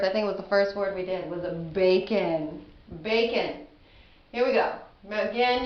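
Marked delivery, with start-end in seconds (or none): 1.3-3.7 slow, clearly split syllables; each syllable of bacon spoken apart; syllable demo. Shown as a demonstration. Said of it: "one of my first words".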